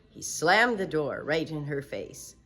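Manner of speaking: usual speaking voice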